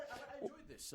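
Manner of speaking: faint, away from the microphone; off mic. Real, not acted. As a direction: speak turned away from the mic. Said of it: "You were off mic".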